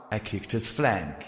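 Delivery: plain reading voice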